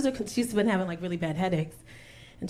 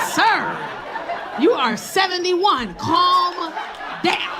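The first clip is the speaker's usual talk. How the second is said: higher, drawn-out exasperated rebuke; mock scolding; 6 semitones higher, much slower than usual. Woman telling old admirer off, exasperated. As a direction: put an eager suitor in his place.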